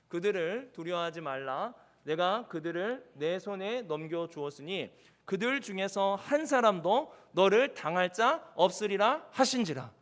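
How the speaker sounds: slow, measured verse reading in separated phrases; congregational scripture reading. Acted. (회중과 함께 또박또박 성경 봉독)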